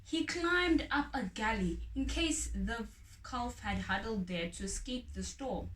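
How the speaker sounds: plain reading voice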